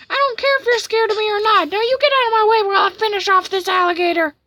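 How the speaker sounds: very high, loud angry character voice; hammed-up, fed-up tirade; fed-up cartoon villain. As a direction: rant in a strained high cartoon voice.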